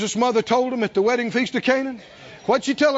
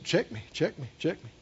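much softer, lower caution; gentle check; 6 semitones lower, 9 dB quieter than usual. Acted. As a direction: quietly hold them back. Voicing the Lord's restraint.

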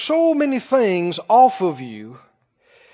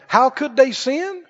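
normal talking voice

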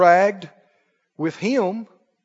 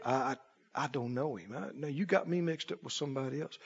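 frightened denial; 7 semitones lower, 18 dB quieter than usual. Retold or imitated. Peter denying, cowering.

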